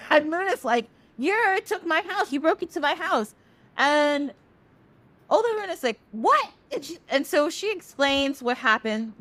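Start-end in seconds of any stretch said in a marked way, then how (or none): 1.2-3.3 higher, aggrieved complaint of a wronged party; indignant accusation. Retold or imitated. Moon accusing Sun before Olorun, indignant and higher. 6.1-6.5 high, sharp disbelief; a startled squeak of disbelief; shocked what. Retold or imitated. Olorun reacting to Sun and Moon's quarrel, shocked and high.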